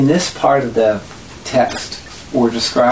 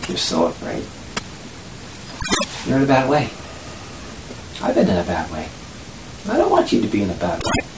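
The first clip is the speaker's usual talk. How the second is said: tender compassion; 7 dB quieter, faster than usual. Acted. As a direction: speak softly to someone who is scared. Compassionate words to a frightened attacker.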